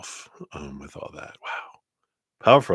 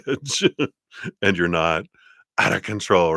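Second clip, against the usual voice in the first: usual speaking voice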